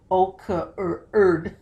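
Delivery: o-c-u-r-d spelled letter by letter, slowly. Shown as a demonstration. Teacher showing students a wrong spelling, slow. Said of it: "That's even hard to say".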